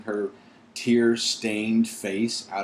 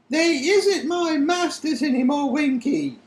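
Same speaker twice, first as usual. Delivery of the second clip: very high squeaky, defiant; defiant elf; 4 dB louder than usual. Acted. Dobby defying Winky in a high elf voice. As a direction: stand up to her in a squeaky elf voice. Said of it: "said Dobby defiantly".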